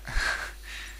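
quiet airy chuckle; soft breathy laugh. Real, not acted. Laughing at his own joke about villagers, quiet chuckle.

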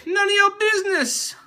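high, put-on snooty voice; mock sassy refusal. Dodging the weight question in a sassy voice. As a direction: brush off the question with fake sass.

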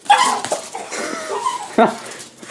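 sudden high breathy laugh; burst of laughter. Real, not acted. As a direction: break into laughter at the dog. Dog goes crazy at laser.

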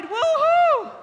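very high, loud cheer; exultant high shout; jubilant whoop. Real, not acted. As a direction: let out a long celebrating "woohoo". Cheering along with the congregation.

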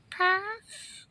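very high, soft little squeak; squeaky Pokemon. Acted. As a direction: chirp it quietly like a tiny creature. Creature Pokemon voiced in a skit.

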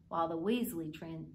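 ordinary delivery

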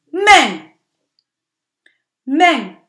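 men said high and loud after the sounds; teacher revealing the blended word. Shown as a demonstration. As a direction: land the whole word men with a burst. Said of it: "what is the word?".

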